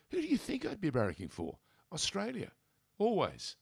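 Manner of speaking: retold indignant reply; emphatic retort. Exasperated retold answer to questioners.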